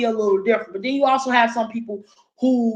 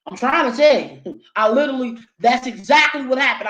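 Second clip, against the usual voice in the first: ordinary delivery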